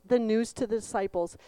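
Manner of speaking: ordinary delivery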